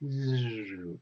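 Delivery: ordinary delivery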